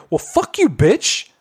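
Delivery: high-pitched mock indignation; retold angry outburst. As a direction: imitate a fed-up customer cursing someone out. Retelling an angry customer's outburst.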